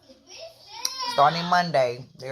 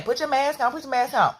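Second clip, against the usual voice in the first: high stern teacher command; teacher scolding; 8 semitones higher than usual. Retold or imitated. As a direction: order them like a strict teacher. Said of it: "The teacher saying".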